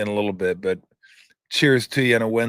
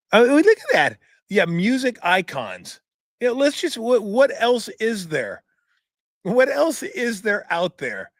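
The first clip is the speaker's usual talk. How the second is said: incredulous mockery; 8 semitones higher than usual. Real, not acted. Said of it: "mocking the list".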